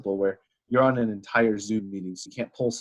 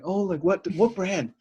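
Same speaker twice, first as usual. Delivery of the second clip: put-on eager questioning girl; 9 semitones higher, slower than usual. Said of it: "it's practically interrogating".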